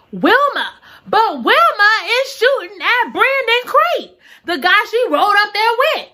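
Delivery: excited reveal. Real, not acted. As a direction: deliver the twist with glee. Twist reveal.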